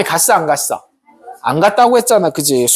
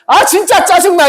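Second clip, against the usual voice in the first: much higher, louder fed-up outburst; irritated; 9 semitones higher, 4 dB louder than usual. Acted. Balaam fed up with the donkey, loud and high. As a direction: vent your frustration loudly. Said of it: "발람이 화가 날까".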